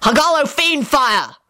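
high, drawn-out incantation; shouted spell. A narrator's reading. Lupin summons a dragon on Scully, shouting. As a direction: call out the spell with force.

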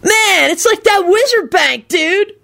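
slow, high dude drawl; gleeful high-pitched amazement; delighted yelp. A narrator's reading. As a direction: marvel like an excited stoner kid. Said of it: "Kenny yelped delightedly".

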